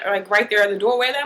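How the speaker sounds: usual speaking voice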